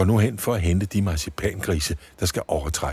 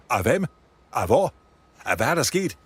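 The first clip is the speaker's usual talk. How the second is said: flustered; 7 semitones higher than usual. Acted. Worker rushes over, flustered.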